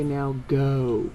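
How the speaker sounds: plain reading voice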